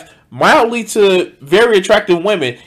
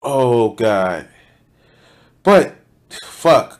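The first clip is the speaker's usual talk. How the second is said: ordinary delivery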